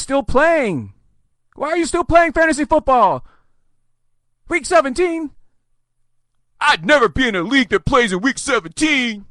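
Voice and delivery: high, hammed-up indignant whine; mock complainer. Mimics complaining week seventeen haters.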